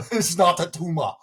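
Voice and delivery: high, straining Austrian action-hero voice; hammed-up Austrian accent through laughter; Schwarzenegger. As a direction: do the movie line in a mock Austrian accent. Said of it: "my German accent is, is confined to Schwarzenegger".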